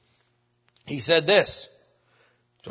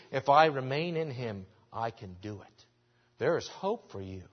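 ordinary talk

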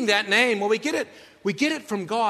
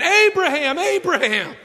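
high, loud call of Abraham; crying out; 9 semitones higher, 7 dB louder, slower than usual. Retold or imitated. Angel stopping Abraham's knife, high loud call. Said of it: "the angel of the Lord cries out".

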